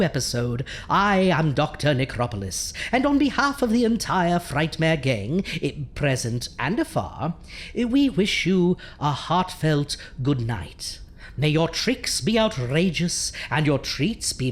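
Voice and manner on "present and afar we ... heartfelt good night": ordinary delivery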